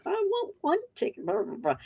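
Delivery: lightly voiced husband insisting on one ticket; mocking husband's demand. Wife mimics husband ordering at the Ferris wheel, lightly mocking. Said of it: "but he was like".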